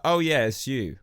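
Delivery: ordinary talk, nothing marked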